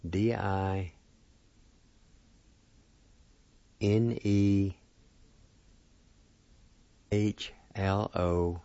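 letters in small groups, long gaps between; spelled out slowly. Shown as a demonstration. Teacher spelling the word for play, slowly letter by letter. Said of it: "It's spelled like this".

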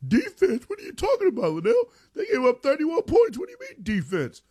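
high-pitched, squawking mock outrage; incredulous naysayer. Radio host mimicking doubters of the defense, high-pitched and incredulous. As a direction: put on a high, disbelieving critic's voice. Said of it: "some people are gonna say".

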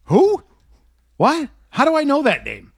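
high, bewildered "who? what?" reaction; baffled surprise. Retold or imitated. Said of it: "To which I say".

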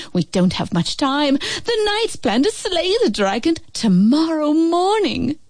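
urgent mother. A narrator's reading. Mother urging.

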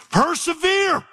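Persevere drawn out high and soaring; soaring plea. Acted. Preacher pleads with the church to endure, soaring high. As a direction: lift Persevere way up like a cry.

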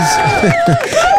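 a short, very high giddy laugh. Real, not acted. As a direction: let a joyful laugh burst out mid-sentence. Giddy over grandchild news.

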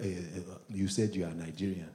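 put-on low, soft Nigerian accent; suspicious tester with a Nigerian accent; quietly probing in a Nigerian accent; Nigerian accent impression. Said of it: "Even Nigerians be testing me".

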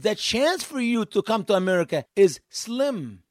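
ordinary delivery

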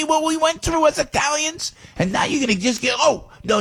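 high, outraged disbelief; indignant rant. Real, not acted. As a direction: shout it in real outrage. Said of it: "Forgive me for ranting".

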